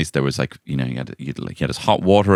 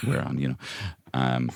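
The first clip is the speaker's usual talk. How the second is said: ordinary talk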